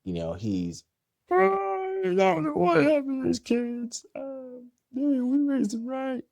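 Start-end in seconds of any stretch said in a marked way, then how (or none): 1.3-6.2 high-pitched mock parental wail; hammed-up distraught dad, far above the usual pitch; dad making a scene. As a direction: imitate a dad lamenting his kids. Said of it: "going, making a scene".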